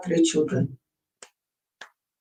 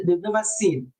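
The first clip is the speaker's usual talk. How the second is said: ordinary talk, nothing marked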